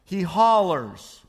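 usual speaking voice

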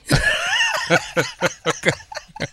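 high burst of laughter; laughing. Real, not acted. Laughs at baby daddy joke.

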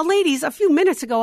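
ordinary talk, nothing marked

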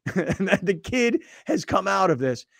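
ordinary talk

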